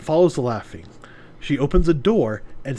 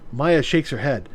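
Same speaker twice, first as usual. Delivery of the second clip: usual speaking voice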